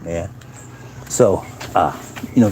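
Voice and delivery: ordinary talk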